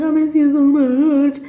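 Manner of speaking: high, stretched-out wail; put-on tearful whine, drawn out; mock crying. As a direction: imitate yourself blubbering to an ex. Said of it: "So I was crying".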